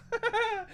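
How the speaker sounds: high, soft burst of laughter; real little giggle between insults; short laugh. Real, not acted. Laughs amid his retold tirade.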